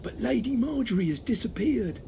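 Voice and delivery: high, lightly voiced manservant; a fretful butler reporting bad news; servant voice. A narrator's reading. Staunchpole servant voice.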